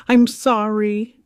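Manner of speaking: slow, loud, high apology; halting apology. A narrator's reading. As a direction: force out a slow sorry. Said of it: "the words sounded like a dress that didn't fit her yet".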